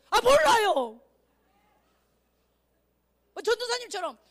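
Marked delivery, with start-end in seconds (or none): 0.1-1.0 loud, high "don't know"; blurted brush-off. Acted. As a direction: blurt it at the professor and bail out. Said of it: "그럼 교수가".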